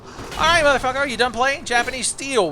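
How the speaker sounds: high, loud taunt at the enemy; taunting shout. Real, not acted. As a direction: call him out, riled up. Challenging enemy mid-fight.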